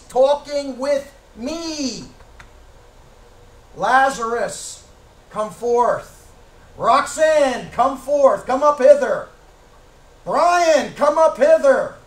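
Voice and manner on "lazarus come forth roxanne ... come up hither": Lord calling names. Retold or imitated. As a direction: call each name out like the Lord raising the dead. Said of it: "the Lord's gonna mention your name".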